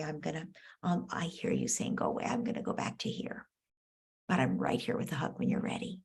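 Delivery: soft whispered reassurance. Shown as a demonstration. Parent stepping back from upset child, whispering. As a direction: whisper it gently, backing off. Parent demoing calm response.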